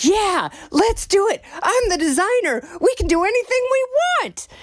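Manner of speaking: high, bright-eyed mock enthusiasm; imitating the designer's giddy, fast confidence; excited designer. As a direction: pitch it way up like an eager designer saying yes. Designer's excited reply, retold.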